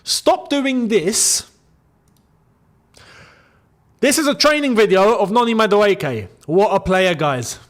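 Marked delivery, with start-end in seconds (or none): 0.0-1.5 slow, deliberate warning; slow stern command. Real, not acted. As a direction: spell it out slowly, stern. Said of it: "pay attention".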